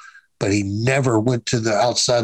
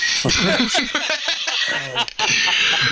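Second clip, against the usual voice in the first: high-pitched burst of real laughter; a long, high laugh that falls away; 12 semitones higher than usual. Real, not acted. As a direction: crack up at the memory. Laughing at his own story.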